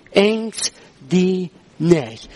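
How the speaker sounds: very slow, word by word; solemn, drawn-out scripture quote; slow, deliberate. Retold or imitated. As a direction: let each word stand on its own. Preacher quotes God's command.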